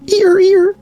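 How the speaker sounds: very high squeaky squeal. Real, not acted. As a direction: let out a tiny delighted squeak. Squealing at a chat gift.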